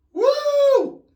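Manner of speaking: sudden high loud whoop; startled whoop. Real, not acted. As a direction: yelp in shock. Startled by water in nose.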